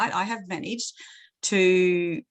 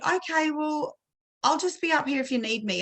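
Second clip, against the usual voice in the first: higher-pitched, easygoing parent voice; gentle reassurance; 7 semitones higher than usual. Retold or imitated. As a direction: back off kindly, leave the offer open. Reassuring upset child, retold.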